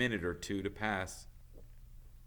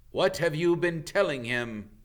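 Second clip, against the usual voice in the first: louder, higher suspicious growl; 7 semitones higher, 7 dB louder than usual. Acted. Bane growling at Firenze, louder and higher. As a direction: demand it with menace. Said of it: "growled Bane".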